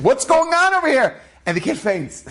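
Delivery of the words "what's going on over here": high, baffled outburst; confused exclamation. Acted. Confused Rav asks as the crowd flees, exclaiming. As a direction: cry out in acted bewilderment. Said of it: "The Rav is so confused".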